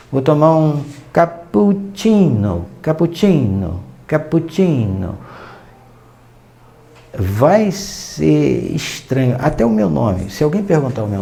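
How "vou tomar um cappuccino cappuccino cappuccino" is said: "cappuccino" com o pp e o cc bem prolongados, devagar; cappuccino alongado. Pretend. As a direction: estique as consoantes dobradas de "cappuccino". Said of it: "imagina você falando". (pedindo um "cappuccino" com sílabas estendidas)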